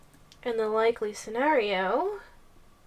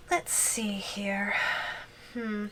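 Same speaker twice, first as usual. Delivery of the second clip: whispered musing; 2 semitones lower, 5 dB quieter, slower than usual. Acted. Muses to herself while choosing.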